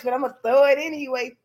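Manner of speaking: ordinary talk, nothing marked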